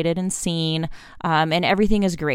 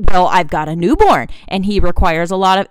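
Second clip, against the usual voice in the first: louder, mock defensive excuse; defensive parent; 8 dB louder than usual. Parent snapping back at a child's feelings, louder defensive. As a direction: push back like a stung parent.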